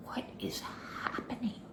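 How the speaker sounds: whispered disbelief, almost silent; stunned whisper. Retold or imitated. Narrator baffled by the neighbor's sudden warmth, whispering. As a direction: breathe it out in shock. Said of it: "And I'm like".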